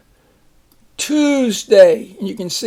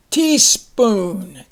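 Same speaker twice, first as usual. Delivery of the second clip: ordinary talk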